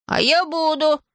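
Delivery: put-on high, stubborn boy's retort; insist, with the pitch held high. A narrator's reading. Schoolboy contradicts teacher who doubts his fame, stubbornly. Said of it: "упрямо проговорил Иван".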